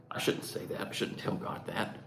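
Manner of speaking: hushed whisper of guilty doubt; a guilty whisper to oneself; whispered hesitation. Retold or imitated. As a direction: whisper it as if afraid to be overheard. Fearful inner hesitation, voice drops.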